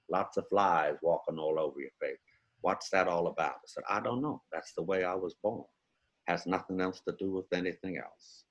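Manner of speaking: low, soft, unhurried film-star voice; Morgan Freeman. Acted. Impressionist voicing an actor telling a story, low soft impression. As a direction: speak low and quiet, musing with hesitant pauses. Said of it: "how about, uh, Morgan Freeman?".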